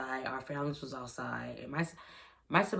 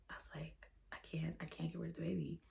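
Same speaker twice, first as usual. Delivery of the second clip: whispered in shock, barely voiced; 3 semitones higher, 10 dB quieter, faster than usual. Real, not acted. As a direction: drop to a stunned whisper. Remembering the shock of ultrasound.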